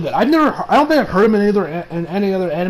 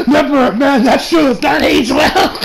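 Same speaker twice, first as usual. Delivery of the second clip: laughing hard through the words, higher; real laughter; 7 semitones higher than usual. Real, not acted. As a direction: crack up while getting it out. Cracking up at a dated line.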